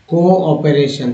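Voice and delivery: कोऑपरेशन said slowly, each syllable clear; slow teaching pronunciation. Shown as a demonstration. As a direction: draw out कोऑपरेशन for the class. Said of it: "याद रखिए". (कोऑपरेशन धीरे-धीरे साफ़ उच्चारण)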